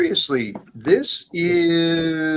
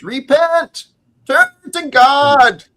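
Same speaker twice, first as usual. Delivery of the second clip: high, ringing call to repent; preacher's proclamation; 13 semitones higher, 6 dB louder, slower than usual. Acted. Speaker passing on John the Baptist's message, proclaiming high. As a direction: cry it out like a prophet, drawn out at the end.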